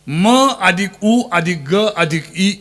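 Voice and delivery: slow sound-by-sound delivery at normal volume; measured spelling-out. Shown as a demonstration. Preacher repeating the word split for listeners, measured spelling-out. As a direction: take each sound slowly, without raising the voice.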